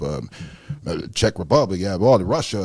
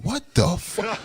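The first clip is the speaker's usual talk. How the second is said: much higher, retold disbelief; imitating his own stunned reaction to the waiter; baffled; 11 semitones higher, 5 dB quieter, slower than usual. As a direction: squeak it out in mock shock. Retold confusion at rude waiter.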